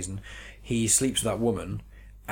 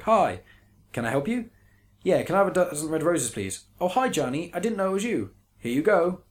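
high, stiff, rushed line reading; wooden movie dialogue; 7 semitones higher than usual. Retold or imitated. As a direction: parody bad acting from The Room. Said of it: "a lot of it is the delivery".

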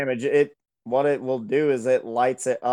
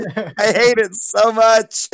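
slow, higher, louder disgust; dramatic disgust; 6 semitones higher, 5 dB louder, slower than usual. Real, not acted. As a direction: drag it out in mock horror. Reacting to cursed image.

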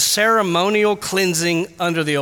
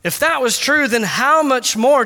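passionate near-shout; 6 semitones higher than usual. Shown as a demonstration. Preacher pressing the point home, impassioned and high. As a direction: lift it up with fervour. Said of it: "voice raising almost to the level of a shout".

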